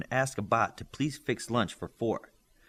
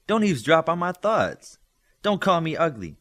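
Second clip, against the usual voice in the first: louder, higher, sharp telling-off; indignant scolding; 7 semitones higher, 6 dB louder than usual. Acted. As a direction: scold him for calling her ugly. Girl scolds boy reading thoughts.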